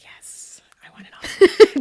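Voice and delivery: whispered, mock-swooning craving. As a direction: whisper it as if overcome with desire.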